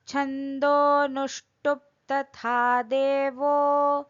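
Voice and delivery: over enunciating. Acted. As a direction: intone each syllable slowly and deliberately. Recites Sanskrit verse, then explains.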